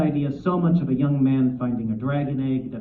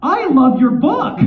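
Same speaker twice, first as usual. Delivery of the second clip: high, loud, drawn-out blurt; acting out a desperate shout of praise; panicked fan shout; 13 semitones higher, 9 dB louder, slower than usual. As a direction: blurt it out to placate a glowering idol. Retells blurting praise to glowering author.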